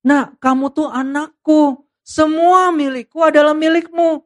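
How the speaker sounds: a father slowly reassuring his son. Acted. Father reassuring the elder son, slow and tender. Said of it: "Bapak teguhkan".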